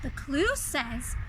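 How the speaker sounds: plain reading voice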